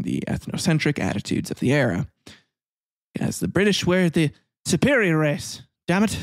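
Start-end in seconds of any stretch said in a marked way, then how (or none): 3.2-6.2 a hammed-up, higher posh English accent; terrible English accent. Mocking the British as superior, in a hammed-up English accent. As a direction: lampoon the smug British with a bad English accent. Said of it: "That's a terrible accent".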